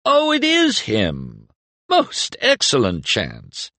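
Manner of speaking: high, slow, delighted cry. Acted. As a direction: burst out in delight. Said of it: "a delighted voice cried out".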